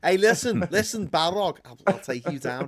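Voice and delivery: loud, put-on Scouse threat; Scouse Gandalf. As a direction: square up to the monster in broad Scouse. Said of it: "What if Gandalf was a Scouser".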